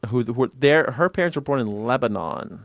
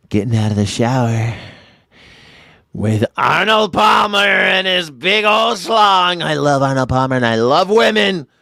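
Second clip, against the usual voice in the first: hammed-up Trump rambling; shouting like a boastful Trump; mock Trump; 4 dB louder than usual. Trump riff continues.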